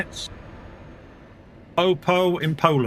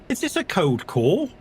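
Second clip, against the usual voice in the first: slow, higher, pointed question; mock questioning; 6 semitones higher, slower than usual. Person challenging a telemarketer on the phone, mock suspicious question. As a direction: draw the question out as if catching them.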